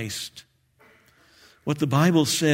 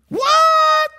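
shocked shriek; 5 dB louder than usual. Acted. Man on cliff hears God.

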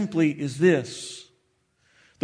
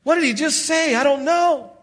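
high, loud baffled huh, then puzzled questions; confused huh; 8 semitones higher, 4 dB louder than usual. Retold or imitated. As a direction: play the lost Bible reader, squeaking out the confusion. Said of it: "you open the Bible and you go".

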